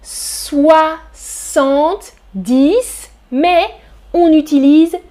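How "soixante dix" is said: soixante-dix stretched out slowly; slow model. Shown as a demonstration. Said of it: "On prononce". (prononcé très lentement : soixante-dix)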